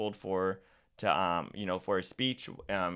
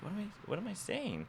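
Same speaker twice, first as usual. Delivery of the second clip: hushed, high mutter to oneself; mock whispered self-questioning; imitated whispering; 13 semitones higher, 8 dB quieter than usual. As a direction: softly puzzle it out under your breath. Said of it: "I'm whispering".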